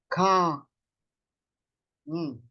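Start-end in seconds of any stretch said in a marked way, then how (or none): 0.1-0.7 "Ka" with a lengthened a; long vowel. Shown as a demonstration. Teacher repeats the dash-mark sound, "Ka" drawn out. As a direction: hold the vowel of "Ka". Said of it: "Maglalagay ka na lang ng dash mark".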